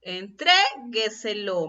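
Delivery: Entrégueselo slowly, syllable by syllable, "tré" held; stress on TRE. Shown as a demonstration. Teacher shows how pronunciation reveals it, "tré" held in Entrégueselo. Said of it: "Me doy cuenta en la pronunciación".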